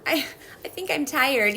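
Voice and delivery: cute drowsy toddler imitation; imitating little girl. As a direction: copy the sleepy kid from the video. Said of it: "that little girl being like".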